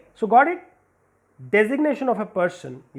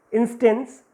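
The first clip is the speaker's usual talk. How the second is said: normal talking voice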